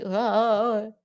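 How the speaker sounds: mock gargled groan; changed voice. Host shows how her voice changed, gargling. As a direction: imitate her voice suddenly changing. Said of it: "in the beginning her bass was all up".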